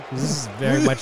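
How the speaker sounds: normal talking voice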